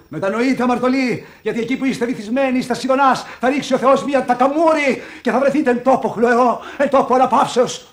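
high, loud preaching voice; mock preacher. Professor preaching repentance at the fiancée, mock sermon voice. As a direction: thunder like a church preacher.

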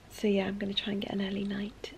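soft bedtime whisper. Real, not acted. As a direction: whisper so as not to wake anyone. Whispering in bed at night.

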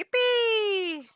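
high, loud cheer of joy; excited yippee. Real, not acted. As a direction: squeal it out in delight. Cheering a scratch-off match.

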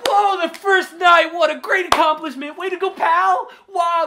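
high, loud hammed-up cheering; mock hype cheer. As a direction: cheer like a sports announcer. Mock celebration of game start.

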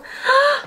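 sharp high gasp of delight; real excited gasp, pitched high. Real, not acted. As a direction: gasp as if the mirror just surprised you. Unboxing a mirror, delighted.

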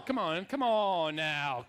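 stretched out "come on now"; slow drawled come on. Shown as a demonstration. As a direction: lean back and drawl it slowly. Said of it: "So let's practice that".

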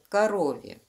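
plain reading voice

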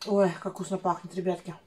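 speaking between bites; chewing while talking. Real, not acted. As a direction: mouth partly full of bread. Seen 0: Bites bread at -1.0, holds it while speaking; mouth likely not empty.

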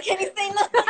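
speaking through laughter; laughing. Real, not acted. Teen laughs mid-rant to her viewers. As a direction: giggle through it. Laughing while talking to chat.

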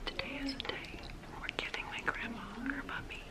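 hushed secret whisper. Real, not acted. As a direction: whisper so she won't hear. Whispered so grandma won't hear.

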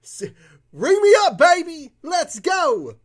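dad shouting with joy at the bike shop; hyped-up dad. Retold or imitated. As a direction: belt it out like a proud dad at the register. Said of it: "And my dad said".

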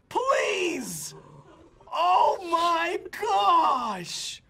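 high, loud, stretched-out yells of disbelief; amazed shouting. Real, not acted. Gamer begging for a replay of a play, amazed shouting. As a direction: shriek it in shock, dragging words out.

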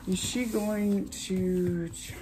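ordinary talk, nothing marked

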